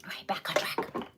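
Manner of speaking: whispered to herself, all breath. Real, not acted. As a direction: hush it as a private reminder. Quiet self-reminder to refocus.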